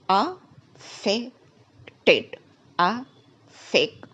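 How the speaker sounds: "Affected" in slow separate beats, uh... FEK... tid; stress on second syllable. Shown as a demonstration. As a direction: break "Affected" up with weight on FEK. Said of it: "stress is on the second syllable".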